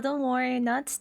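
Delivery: ordinary talk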